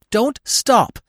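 loud, urgent cry of command. A narrator's reading. Mother fleeing past her fawn, urgent loud command. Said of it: "a voice beside commanded".

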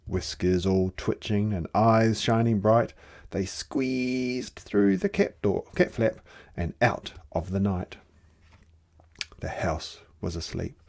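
ordinary delivery, nothing marked

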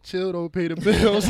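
mocking through a laugh. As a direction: laugh while quoting. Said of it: "said to myself in my head".

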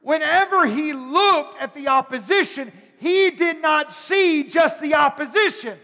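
loud, high preached scripture building up; fiery preaching. Acted. Pastor preaches Romans to the congregation, loud and building. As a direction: preach it out with rising fire.

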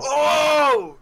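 high, loud gleeful shout; excited shout. Real, not acted. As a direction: whoop with delight. Excited reaction to a subscriber.

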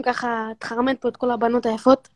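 normal talking voice

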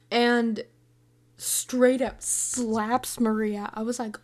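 normal talking voice

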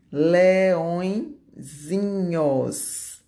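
"Leõezinhos" very drawn out, split before the ending; long, stretched "Leõezinhos"; slow dictation. Shown as a demonstration. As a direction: dictate "Leõezinhos" slowly in two parts. Teacher dictates the diminutive in parts.

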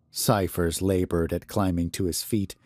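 ordinary delivery

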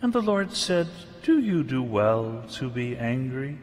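loud, high, earnest Bible reading; scripture reading. Acted. Preacher reading Jonah aloud to the congregation, loud and solemn. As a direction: proclaim the passage to the congregation.

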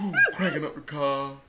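breathless, laughing gasps; panting after striding to the car; out of breath. Real, not acted. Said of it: "I'm out of breath".

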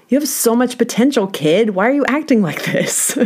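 a touch of exasperated care; urging teacher. Retold or imitated. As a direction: coax the kid to see himself. Teacher urging student, retold.

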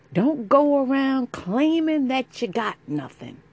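high, loud mocking imitation. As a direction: mimic them in a high sneer. Mocking what people say.

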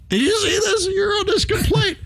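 high squealing mock teasing; mocking coworkers. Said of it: "They're like".

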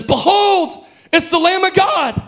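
high, ringing preacher's proclamation; fiery preaching. Acted. Preaching at full force.

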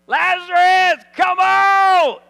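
high, drawn-out booming shout; loud calling out. Acted. Jesus calls dead Lazarus from the tomb, loud summoning shout. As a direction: shout it slowly, summoning someone from afar.

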